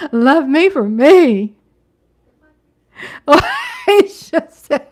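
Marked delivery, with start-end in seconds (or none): none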